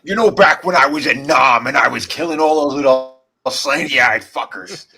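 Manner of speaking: lightly voiced gruff tough-guy boast; Jesse Ventura impression. Hinting at Jesse Ventura telling war stories, lightly. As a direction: brag like a war-hardened wrestler. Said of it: "Jesse Ventura".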